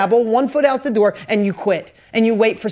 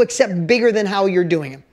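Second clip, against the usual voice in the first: ordinary talk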